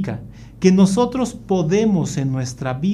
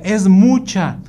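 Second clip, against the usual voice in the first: put-on higher, louder kid's complaint; complaining child; 5 semitones higher, 6 dB louder, slower than usual. Son complaining to dad about water, high loud protest. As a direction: object like a picky child.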